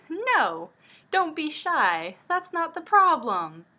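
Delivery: high and loud, pushing him away; panicked protest. Acted. Shizuku fighting off the man's kiss, high loud panicked shouting.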